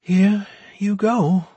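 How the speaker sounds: slow, high, drained voice; weak voice. A narrator's reading. Priestess hands back the marble, in a weak voice. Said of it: "said in a weak voice".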